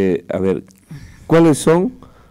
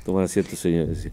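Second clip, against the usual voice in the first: lower, softer formal classroom command, lightly imitated; a hint of an old-school teacher seating the class; imitating a formal teacher telling pupils to sit, lower and quieter; mock schoolteacher; 8 semitones lower, 4 dB quieter than usual. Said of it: "Así era en la escuela y en el colegio".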